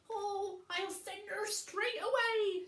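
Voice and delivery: high, loud, hurried promise; eager frightened old man. Acted. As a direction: blurt out the promise nervously. Old man's frightened reply.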